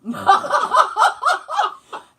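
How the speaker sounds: loud, high cackling burst; real high-pitched belly laugh; big laugh. Real, not acted. Cracks up at own story.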